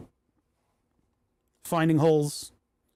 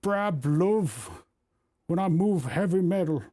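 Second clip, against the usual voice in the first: lightly put-on northeast England accent; hammed-up Geordie-ish grandpa accent; northeast English accent; slower than usual. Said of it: "what accent to do on that".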